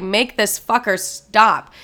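slow, emphatic plea; drawn-out, insistent appeal to God; exasperated prayer. Retold or imitated. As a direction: retell a desperate prayer, stretching the words. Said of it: "I'm praying to the Lord".

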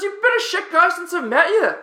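high, loud mock-Aussie rant; screaming Marcus. Teammate berating a man he just met, screaming. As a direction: yell it up high, outraged. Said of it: "Marcus screaming".

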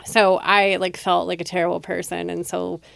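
ordinary delivery

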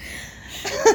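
short breath-filled laugh; real unforced laugh. Real, not acted. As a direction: chuckle at a friend's joke. Laughing at listener's quip.

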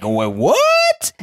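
high, stunned double take; shocked wait what. Retold or imitated. Replying to the email about a donor, in stunned disbelief. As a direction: blurt it out in disbelief. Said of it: "that's literally the way I replied".

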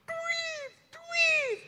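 piercingly high two-note whistle call; imitated crowd whistles. Ambon church cheers the preacher, very high whistles. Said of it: "itu gereja Ambon sampai".

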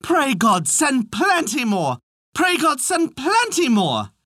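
high, slow chanted call; drawn-out singsong refrain; repeated cry. A narrator's reading. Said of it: "he went on with his".

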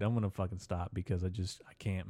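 ordinary talk, nothing marked

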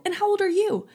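high, pointed Karen question; inner Karen. Retold or imitated. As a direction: interrogate the little kid like a Karen. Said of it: "pulled out my inner Karen".

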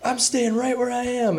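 high, slow, dug-in put-on voice; high slow stubborn caller voice; imitated stubborn angry caller. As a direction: plant your feet as the grumpy caller. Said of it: "he basically was screaming at me".

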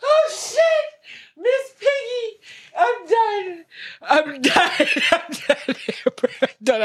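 high squeaky laughing voice; cracking up, barely getting words out; laughing hard. Real, not acted. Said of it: "I hate that you keep a straight face".

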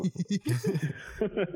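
high-pitched laughing fit. Real, not acted. Co-host cracks up at the plea for Joe, laughing.

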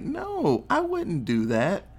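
high, drawn-out protest; lightly acted, high-pitched "who, me?"; mock innocent denial. Boyfriend denying it to his accuser, mock innocent.

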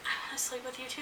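breathy unvoiced whisper; a secret whispered invitation. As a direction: whisper it into her ear. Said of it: "somebody came up and whispered".

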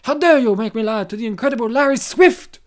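hammed-up indignant boaster; mock-outraged character impression; put-on character voice. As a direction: imitate a self-important man taking offence. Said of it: "He sounds basically like how I did it before".

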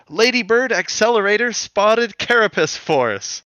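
high hero transformation call. Retold or imitated. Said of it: "Dot is like".

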